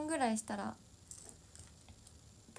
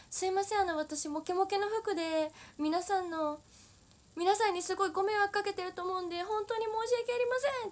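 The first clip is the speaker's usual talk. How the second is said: much higher, louder, earnest formal apology; teammate's earnest, much higher, loud apology to the dancers; 11 semitones higher, 4 dB louder than usual. Retold or imitated. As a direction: apologize loudly to the whole room. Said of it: "めっちゃ大きい".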